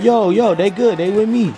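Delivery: high, urgent call to calm things down; high-pitched voice of a friend calling "chill". Retold or imitated. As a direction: call out to stop the fight. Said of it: "He talking about".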